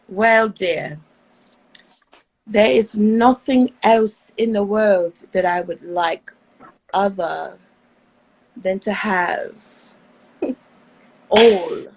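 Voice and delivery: lightly put-on sultry British lady; seductive posh voice. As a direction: purr it out like a posh temptress. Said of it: "Okay, give us a voice".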